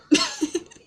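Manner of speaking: sudden high, loud laugh; real outburst of shocked laughter; burst of laughter. Real, not acted. Laughing at the stranger's crude question, loud and high.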